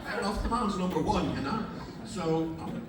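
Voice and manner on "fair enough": normal talking voice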